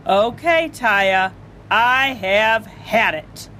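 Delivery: each word a warning; stern father. Acted. Father orders Taya to stand still, slow and firm.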